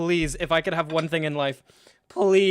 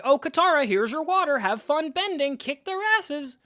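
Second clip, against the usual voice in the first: mocking high voice; 11 semitones higher than usual. Streamer mocks Sokka handing Katara water, high squeaky mock voice. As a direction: lift the voice way up to mock a wimpy helper. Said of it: "He's literally like".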